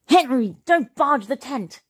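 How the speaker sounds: high, scolding yell; yelling dad. A narrator's reading. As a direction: yell a warning at your son. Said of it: "yelled Dad".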